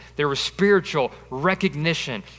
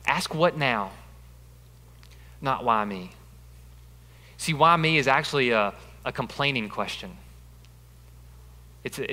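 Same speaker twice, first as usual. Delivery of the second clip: ordinary talk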